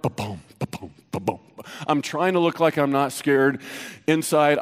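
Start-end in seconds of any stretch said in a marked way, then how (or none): 0.0-1.4 low, soft thumping heartbeat sound. Retold or imitated. A cop telling the church how his heart pounded, low heartbeat thumps. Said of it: "my heart".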